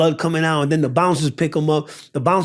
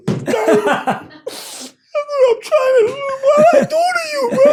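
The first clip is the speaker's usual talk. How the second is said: very high, loud, tearful mock scream; retold screaming; 5 dB louder, slower than usual. Pleading with the man at the door, hammed-up screaming. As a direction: wail in hammed-up panic. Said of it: "I'm screaming and all that".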